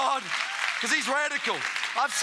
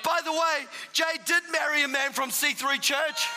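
raised, driving sermon voice; preacher urging the church on; full-force preaching; 5 semitones higher than usual. Acted. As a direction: press the congregation to keep praying.

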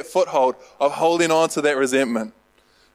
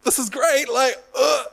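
1 overexcited Peter; 9 semitones higher than usual. Retold or imitated. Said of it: "just trying to be like".

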